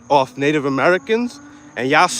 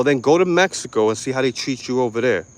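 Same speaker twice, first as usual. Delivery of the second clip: usual speaking voice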